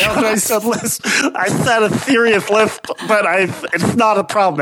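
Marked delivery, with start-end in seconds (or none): 0.0-2.9 lisping, th for s in serious. Shown as a demonstration. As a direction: say serious as thirious. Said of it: "it's coming back".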